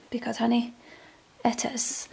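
soft unvoiced whisper, sassy; whispered aside. Real, not acted. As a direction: breathe it out as a knowing aside.

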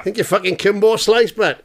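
mock tough retort. Mock fight banter back.

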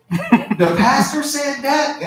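mock surprise. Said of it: "some of them dudes is like".

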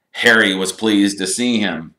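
plain reading voice

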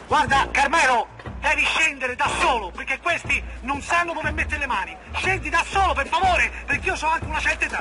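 high-pitched, urgent calling; pleading shout. Acted. Begging a man to climb down, pleading shout.

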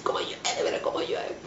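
hushed, whispery mock scream; imitated shouting. Mother shouting to children during the earthquake, mimicked in a whisper. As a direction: mimic yelling in alarm with hardly any voice. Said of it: "Yo gritaba así".

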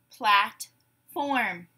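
"Platform" in two separated beats; syllable by syllable. Shown as a demonstration. Teacher gives syllables to blend, "Platform" broken apart. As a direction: say "Platform" slowly as plat, pause, form. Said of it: "I will say syllables in a word".

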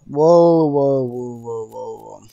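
loud burst of repeats, tapering off; startled. Real, not acted. Excited reaction in game.

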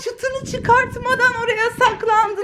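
high, laughing shock after a scare; startled laughing. Real, not acted. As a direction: gasp and laugh at the surprise. Startled by found child, laughing.

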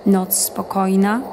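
usual speaking voice

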